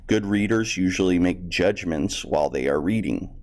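usual speaking voice

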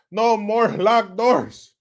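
drawn-out, loud slogan; shouted catchphrase. Acted. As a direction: call it out word by word.

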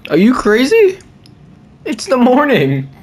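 high, disbelieving cry of protest; outraged that it's bedtime already; incredulous protest. Real, not acted. Player protesting to the game mom, high and incredulous.

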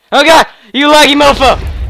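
loud, high complaint; exasperated outburst. Real, not acted. Gamer to a lagging opponent, loud exasperation.

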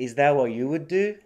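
"Is that what you would do" with no T in "that" or "what"; dropped Ts, linked. Shown as a demonstration. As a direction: run "would do" together on one D in "Is that what you would do". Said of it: "ThatのTが落ちてて".

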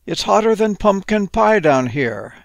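high-pitched storybook plea; crying out. Acted. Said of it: "he cried out".

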